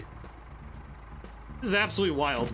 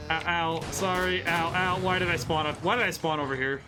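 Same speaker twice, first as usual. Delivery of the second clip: normal talking voice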